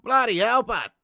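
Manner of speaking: hammed-up Aussie wildlife-host amazement; Australian accent. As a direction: marvel like an exaggerated Aussie bloke.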